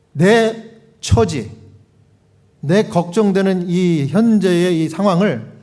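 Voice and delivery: ordinary talk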